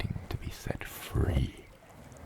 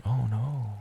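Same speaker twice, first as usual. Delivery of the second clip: mock dismay; 8 semitones higher than usual. Mock dismay at being caught.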